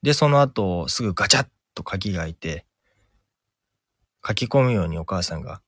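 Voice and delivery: usual speaking voice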